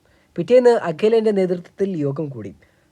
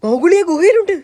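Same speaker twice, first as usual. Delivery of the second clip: alarm call; 11 semitones higher, 5 dB louder than usual. Acted. Bird calls alarm to jungle.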